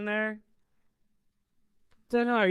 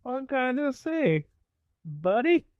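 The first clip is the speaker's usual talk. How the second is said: high, overfriendly character greeting; chummy greeting; 9 semitones higher than usual. Acted. Buttering up Lee at the door, high and chummy. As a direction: pitch it high like an old pal.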